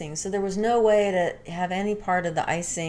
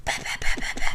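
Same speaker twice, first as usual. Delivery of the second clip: spanking noises; 3 dB louder, faster than usual. Retold or imitated. Imitating spanking sounds.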